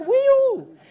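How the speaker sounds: loud, very high exclamation; comic high-pitched whoop; mock dismayed whoop. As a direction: imitate an overwhelmed reaction, hammed up. Boyfriend reacting to the workload.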